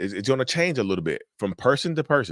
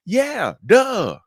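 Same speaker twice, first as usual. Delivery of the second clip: high sarcastic duh; 13 semitones higher, 6 dB louder than usual. Acted. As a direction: scoff it off sarcastically. Sarcastic retort to viewer.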